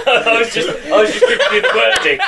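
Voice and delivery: ordinary talk, nothing marked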